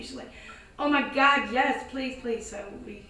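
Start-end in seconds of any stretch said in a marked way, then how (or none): 0.8-2.5 relieved plea. Retold or imitated. Owner accepts help, relieved.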